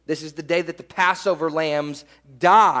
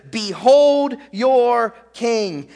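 BEHOLD, YOUR, KING each stretched, higher; grand proclamation; 4 semitones higher, 4 dB louder, much slower than usual. Retold or imitated. Acting Pilate's provocative proclamation.

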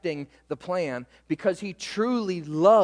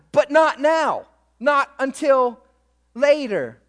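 higher, louder, building sermon voice; preaching at full force; 5 semitones higher, 6 dB louder than usual. Acted. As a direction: press the promise home to Peter. Preacher voicing Jesus with force.

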